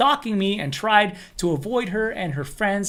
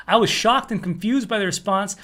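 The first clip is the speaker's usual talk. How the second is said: ordinary delivery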